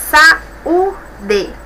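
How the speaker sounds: "saúde" split into three separate syllables. Shown as a demonstration. Teacher showing students the hiato, "saúde" syllable by syllable. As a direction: break "saúde" apart as sa-ú-de. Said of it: "ao separar sílabas".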